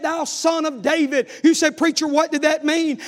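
ordinary delivery

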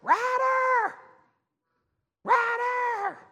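long, high-pitched calling holler. Retold or imitated. Mother calling her son across the field, hollering. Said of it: "I heard Brandy down there in that field hollering".

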